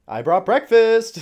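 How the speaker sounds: loud, high, drawn-out announcement; cheery call-out. Acted. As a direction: sing out the arrival of a meal. Calls out to hungry prisoner.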